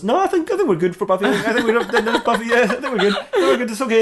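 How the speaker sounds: high, quick brush-off; hasty dismissal. Acted. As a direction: wave the idea away too eagerly. Batman hastily turns down Buffy idea.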